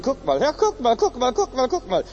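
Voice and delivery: high, jeering guck mal; mocking priests. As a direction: taunt him like a scoffing priest. (höhnisch spottend)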